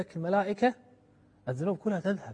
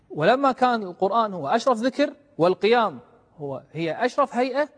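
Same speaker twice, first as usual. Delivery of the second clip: louder, higher urging from the pulpit; fervent preaching; 7 semitones higher, 10 dB louder than usual. Acted. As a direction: press the point home to the listeners. Preacher building to a climax.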